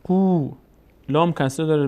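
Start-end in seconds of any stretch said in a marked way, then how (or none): 0.0-0.5 قو higher, with a held "oo"; long vowel. Shown as a demonstration. Teacher showing qaf before waw, long vowel. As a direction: stretch the oo in قو. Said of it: "صدای او".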